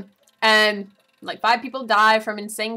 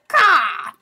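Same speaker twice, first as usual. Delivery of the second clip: high, loud imitated caw; crow caw; 10 semitones higher, 8 dB louder than usual. Crow calling out before the attack, high loud caw. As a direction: squawk it like a crow. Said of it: "the crow just goes".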